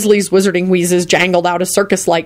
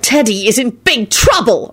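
understated fury, pitched higher; mildly raised, cross warning; furious yell; 4 semitones higher than usual. A narrator's reading. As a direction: threaten punishment with emphasis. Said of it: "Elizabeth yelled furiously".

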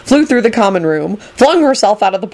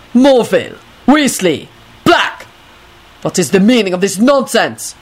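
emphatic, separated roll-call of names; outraged schoolmaster's reprimand; stern demand. A narrator's reading. Dragomir angrily calls out pupils.

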